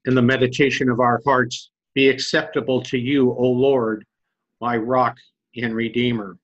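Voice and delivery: loud, solemn opening prayer. Acted. Pastor opening the sermon with a loud solemn prayer. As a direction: intone it as a prayer before preaching.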